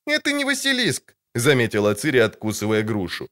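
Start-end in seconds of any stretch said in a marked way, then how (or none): 0.1-1.2 put-on much higher, young girl's pert voice; a sharp, high girlish retort. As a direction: contradict him like a cheeky girl.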